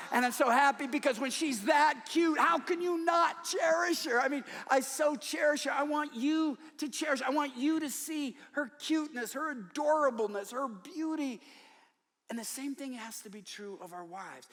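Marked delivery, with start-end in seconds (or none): 0.1-9.6 high, loud, gushing joy; proud excitement. Real, not acted. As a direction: shout it out like a proud grandpa.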